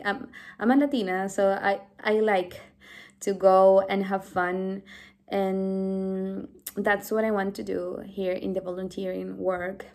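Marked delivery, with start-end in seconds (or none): none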